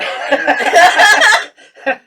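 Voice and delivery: loud high laugh; pained laugh. Real, not acted. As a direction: burst out laughing. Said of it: "my mouth is on fire".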